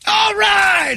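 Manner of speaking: very high mock shout; imitating Brady. Host imitating Brady bursting in mid-show, high mock shout. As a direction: yell it like an over-eager announcer. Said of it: "you'll hear Brady go".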